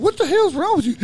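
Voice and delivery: high, loud retold demand; alarmed yell. Retold yelling at panicking friend.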